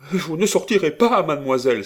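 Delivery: very high, loud prohibition; furious outcry. A narrator's reading. Old lady forbidding the young woman to leave, furious outcry. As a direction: forbid it in a high, outraged cry. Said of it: "s'écria madame Desroches".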